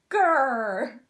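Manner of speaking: short loud growly babble; playful baby dragon grrr; dragon growl. Acted. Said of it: "Crackle babbled".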